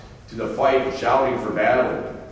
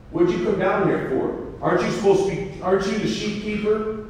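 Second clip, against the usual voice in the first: higher, teasing sneer; mocking brother; 9 semitones higher than usual. Oldest brother mocks David, higher teasing voice. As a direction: make fun of him. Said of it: "he's made fun of by his oldest brother".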